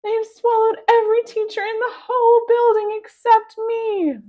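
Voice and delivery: high, loud frantic plea; scared teacher begging loudly; panicked. A narrator's reading. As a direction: keep the terror up, pleading for rescue. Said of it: "she cried".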